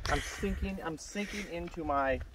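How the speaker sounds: voicing the baby. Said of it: "He's like, "Dad, ... I'm sinking down".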